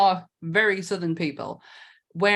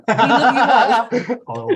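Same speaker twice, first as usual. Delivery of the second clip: laughing apology; 8 dB louder, much slower than usual. Acted. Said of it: "arro-, a little bit".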